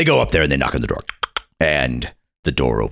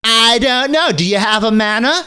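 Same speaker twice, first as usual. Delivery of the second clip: put-on much higher, prim boy's voice; snooty little boy. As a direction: look down your nose like a posh little boy. Boy questioning the explorers.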